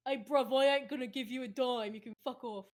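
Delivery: loud, rough street British accent; heavy British accent. Shown as a demonstration. Showing really heavy accents, loud British street talk. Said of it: "it's, like, stuff like that".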